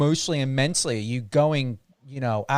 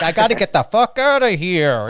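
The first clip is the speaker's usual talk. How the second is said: louder and higher, bursting out to escape; restless inner voice; 4 semitones higher, 6 dB louder than usual. Retold or imitated. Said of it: "the other one's like".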